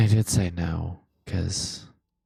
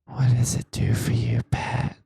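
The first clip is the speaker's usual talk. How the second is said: creepy ASMR whisper; 3 semitones higher than usual. Pretend. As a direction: whisper it like a taunting stalker. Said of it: "Never mention your voice again".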